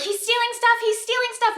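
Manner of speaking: high-pitched mock yell, no real volume; retold screaming. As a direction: imitate frantic calling out a window. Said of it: "We're screaming out the window".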